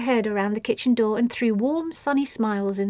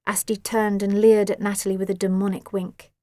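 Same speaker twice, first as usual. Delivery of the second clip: ordinary delivery, nothing marked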